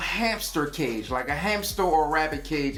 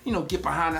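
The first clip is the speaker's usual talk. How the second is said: ordinary delivery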